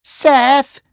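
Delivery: put-on little toy shouting for the boy. A narrator's reading. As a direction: yell after him in alarm. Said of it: "shouted Ellie".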